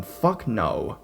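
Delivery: usual speaking voice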